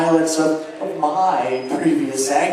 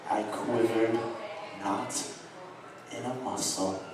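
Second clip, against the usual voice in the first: hushed narration; 9 semitones lower, 16 dB quieter than usual. A narrator's reading. Narrator confessing his calm, hushed and low. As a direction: drop to a low, soft near-murmur.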